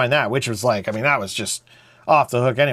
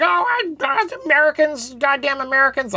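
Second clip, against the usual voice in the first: high-pitched mock complaint; put-on, high-pitched griping; grumbling Canadians; 11 semitones higher than usual. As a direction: imitate disgruntled Canadians in a high voice. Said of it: "a lot of Canadians who be like".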